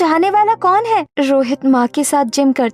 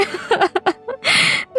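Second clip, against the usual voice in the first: sob; 4 semitones higher, 4 dB quieter than usual. Acted. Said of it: "ये वक्त रोने का नहीं है".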